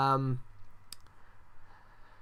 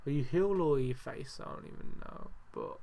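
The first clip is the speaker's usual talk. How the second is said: normal talking voice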